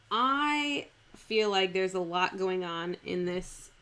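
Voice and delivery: ordinary talk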